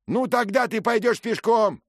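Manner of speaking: high, loud mocking threat; shouted threat. A narrator's reading. Stranger shouts threat across river.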